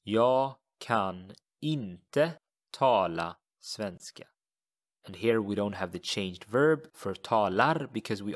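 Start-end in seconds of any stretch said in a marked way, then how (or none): 0.0-4.3 Jag kan inte tala svenska, slow and careful; slow teacher demo of the Swedish; slow model sentence. Shown as a demonstration. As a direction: model every word slowly. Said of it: "I cannot speak Swedish".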